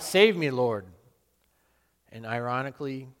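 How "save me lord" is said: loud desperate plea while sinking; frightened loud cry to the Lord; cry for help. Retold or imitated. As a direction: call out in panic to be saved. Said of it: "I cried out".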